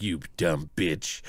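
deep slow hammed-up Terminator insult; mock Terminator sneer. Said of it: "she's like".

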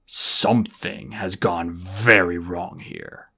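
slow, low, grave menace; ominous dragon. A narrator's reading. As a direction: deliver it like a verdict. Moroseer addressing the Guardians.

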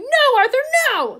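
very high, very loud shriek. Acted. Wife shrieks at her husband to stop fighting, very high and loud. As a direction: scream at him to stop. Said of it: "Missus Weasley was shrieking".

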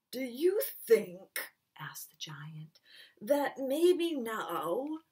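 slow, breathy hopeful question; hushed hopeful giant. Acted. Giant shyly asking Carolinda whether the moon likes him. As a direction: ask it shyly, half whispered.

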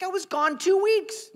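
very high, loud squawk of disbelief; mock outrage. Pastor confronting Dan after trip, mock outrage. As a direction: protest at the top of your range.

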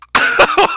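high squealing laugh; wheezing laugh. Real, not acted. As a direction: break into a shrill cackle. Laughing at hand gesture.

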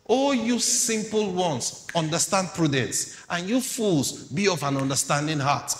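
forceful sermon reading; preaching at full force. Acted. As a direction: proclaim it to the crowd. Preaching scripture.

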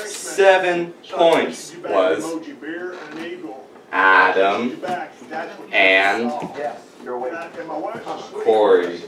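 slow drawn-out reveal; suspense reveal. Acted. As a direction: hold back the name. Results reveal.

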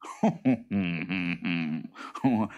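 drawn-out pondering creature chuckle; boggart chuckle. A narrator's reading. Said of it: "chuckled the boggart".

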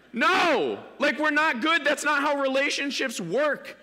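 loud, high burst of protest; emphatic no. Real, not acted. Preacher tells the congregation gifts aren't apology, loud and high. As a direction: reject it outright, loud and high.

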